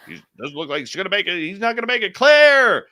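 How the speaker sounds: fast, rising into a loud shout; doctor building to a shout. Retold or imitated. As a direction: panic builds to a yell. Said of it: "they have a doctor say".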